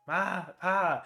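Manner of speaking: mock shouting. A narrator's reading. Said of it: "As you're shouting".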